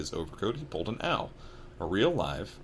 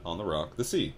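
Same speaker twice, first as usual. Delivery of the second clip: ordinary delivery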